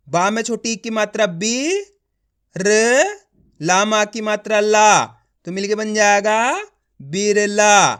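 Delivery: teacher's paced build-up to a drawn-out "बिरला"; letter-by-letter sounding out. Shown as a demonstration. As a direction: rise on "बी", hold "र" alone, stretch "ला", then blend "बिरला". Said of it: "एक एक अक्षर का उच्चारण करके पढ़ रहा हूँ".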